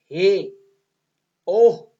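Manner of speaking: loud sudden exclamations; teacher demonstrating exclamation words, loud and sharp. Shown as a demonstration. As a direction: exclaim each word sharply. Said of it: "इस तरह के अगर word आएंगे".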